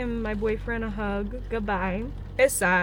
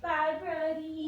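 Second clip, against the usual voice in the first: tearful goodbye; 6 semitones higher, 4 dB quieter, slower than usual. Real, not acted.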